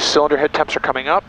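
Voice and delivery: usual speaking voice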